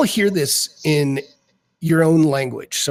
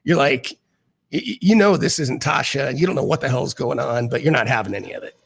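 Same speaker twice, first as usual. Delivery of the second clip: usual speaking voice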